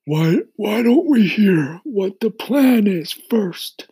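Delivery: put-on high, loud, slow old voice; old grasshopper voice. A narrator's reading.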